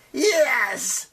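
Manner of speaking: loud, high proud shout. Acted. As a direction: crow it out loud. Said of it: "shouted one of her children proudly".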